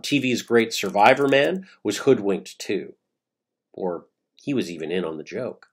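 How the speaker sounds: ordinary talk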